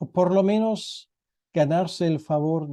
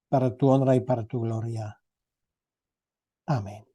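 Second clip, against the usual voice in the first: low, unhurried prayer voice; 3 semitones lower than usual. Acted. Pastor praying for his listeners, a low humble prayer. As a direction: pray humbly, addressing God.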